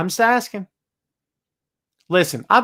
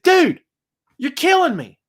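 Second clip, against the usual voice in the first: exasperated complaint; 8 semitones higher than usual. Retold or imitated. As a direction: snap at the heckler, fed up. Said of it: "Just like that".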